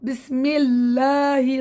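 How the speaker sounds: slow, drawn-out بسم الله with full heavy لام in الله; slow demo of Allah. Shown as a demonstration. Teacher slowly models بسم الله for the student. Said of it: "لام اسم الجلالة".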